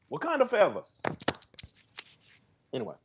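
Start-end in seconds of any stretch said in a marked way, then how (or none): 0.1-0.8 salute like a Wakandan warrior; Wakanda salute. Acted. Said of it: "something they do in the movie".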